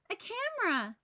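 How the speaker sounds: excited. A narrator's reading. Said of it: "picking it up".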